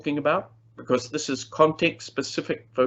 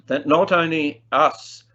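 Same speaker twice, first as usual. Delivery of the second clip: ordinary talk, nothing marked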